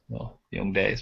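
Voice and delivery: ordinary talk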